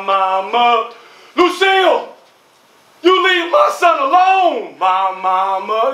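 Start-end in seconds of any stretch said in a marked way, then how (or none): 1.4-4.8 high angry shout; mother shouting to protect her son; furious mother. Acted. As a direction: yell a warning at the other mom. Mother threatening.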